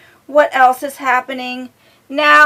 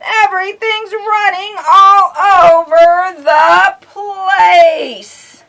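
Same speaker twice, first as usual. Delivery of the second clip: hammed-up wail, higher and louder; mock despair; 8 semitones higher, 8 dB louder, much slower than usual. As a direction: wail it like a frazzled shopper.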